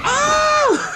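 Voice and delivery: high-pitched mock shriek; hammed-up yelp of fright; mock startled scream. Sidney seeing the mask held up, mock scream.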